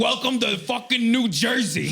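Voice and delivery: high, loud New Jersey tough-guy; hammed-up New Jersey accent, shouting; a New Jersey native shooting at a UFO, New Jersey accent. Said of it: "in his New Jersey accent".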